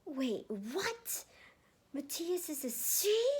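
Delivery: high, loud stunned question; can't believe it; shocked teen. Acted. Matthew learning Matthias is a girl, high and shocked.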